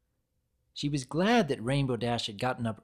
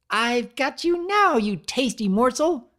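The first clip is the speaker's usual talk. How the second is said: maniacal gloat; 11 semitones higher, 7 dB louder than usual. A narrator's reading. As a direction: savour the catch like a gleeful villain. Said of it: "Pinkie grinned maniacally".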